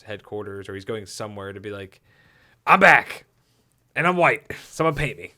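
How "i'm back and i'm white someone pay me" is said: high, loud hammed-up announcement; swaggering mock declaration; mock triumphant boast. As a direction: crow it like a returning hero.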